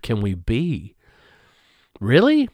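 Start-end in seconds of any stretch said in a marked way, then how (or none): 2.0-2.5 "Really?" jumps far higher in disbelief; indignant disbelief. Real, not acted. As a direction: throw it out high, appalled. Indignant rhetorical question.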